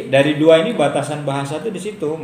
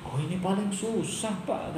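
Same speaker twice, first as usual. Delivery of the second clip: lightly voiced child, higher and much softer; child's excuse; 6 semitones higher, 9 dB quieter, slower than usual. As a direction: mimic a sheepish kid, quietly.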